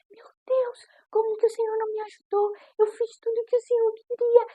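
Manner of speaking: high, breathy whispered plea to God, retelling the despair; desperate plea. Retold or imitated. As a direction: whisper it high up, as if heartbroken and barely holding on. (súplica sussurrada e aguda, desesperada)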